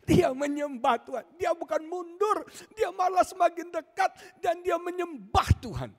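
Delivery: high, loud, impassioned preacher voice; full-force preaching. Acted. Preacher to congregation, high and loud, impassioned. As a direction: drive the story home to the crowd, loud and high.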